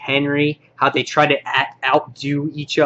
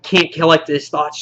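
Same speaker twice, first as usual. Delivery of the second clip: ordinary delivery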